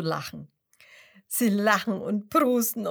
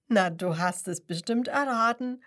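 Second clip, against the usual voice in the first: ordinary delivery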